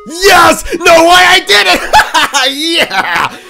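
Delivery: loud, high triumphant yelling; victory shout. Real, not acted. Gamer cheering at the game after winning the night. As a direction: cheer at the top of your lungs.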